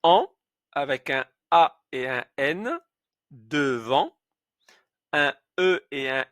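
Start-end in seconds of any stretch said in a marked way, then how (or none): 0.0-0.3 « en » bien nasal, bouche ouverte; son nasal an. Shown as a demonstration. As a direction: faites passer « en » par le nez. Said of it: "Ce symbole-ci se prononce". (le professeur montre le son nasal « en »)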